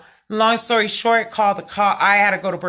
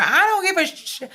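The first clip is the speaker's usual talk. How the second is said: sudden high-pitched defiant blurt; defiant outburst; 11 semitones higher than usual. Retold or imitated. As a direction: toss it off, fed up and past caring. Retelling losing it at cashier.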